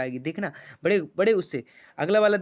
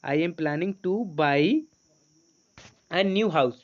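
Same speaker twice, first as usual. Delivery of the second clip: words stretched very slowly; very slow read-aloud; much slower than usual. Shown as a demonstration. Teacher reading the sentence slowly for students to repeat. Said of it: "यहाँ पर set हो रहा कि नहीं".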